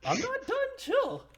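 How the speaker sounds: high-pitched plea through laughter; giggly, squealed objection; laughing protest. Real, not acted. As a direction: beg them to wait while cracking up. Laughing while begging players to wait.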